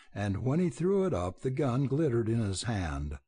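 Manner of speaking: ordinary delivery, nothing marked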